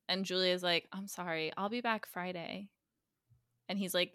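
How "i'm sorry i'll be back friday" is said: quietly voiced, retold sorry; subdued parting line; soft acted apology. Retold apology before leaving.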